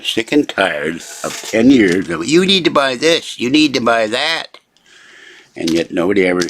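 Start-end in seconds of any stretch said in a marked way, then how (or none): 2.3-4.6 sing-song, higher mock nagging on "need"; sarcastic imitation of pushy advice; mocking quote. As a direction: parrot the advice back with a sneer. Mocking people's buying advice.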